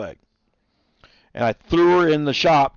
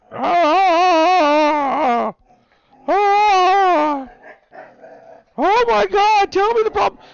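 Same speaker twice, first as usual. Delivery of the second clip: very high, louder squeal at the pet; squeaky dog-talk voice; 5 dB louder, much slower than usual. Real, not acted.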